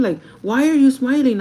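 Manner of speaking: loud, high-pitched demand; upset boyfriend. Retold or imitated. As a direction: imitate a boyfriend confronting you. Boyfriend repeats his confrontation.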